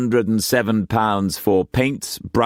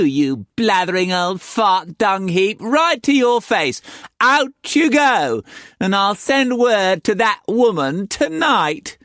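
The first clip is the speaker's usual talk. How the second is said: furious madam; 13 semitones higher than usual. A narrator's reading. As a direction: tear into him.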